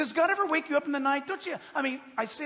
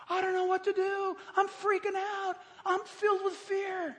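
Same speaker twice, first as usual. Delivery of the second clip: mock panic; 8 semitones higher than usual. Preacher voicing someone filled with fear, mock panicked wail. Voices a panicking person without prayer.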